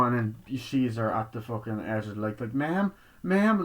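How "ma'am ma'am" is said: calling out. Retold or imitated. Calling to old woman.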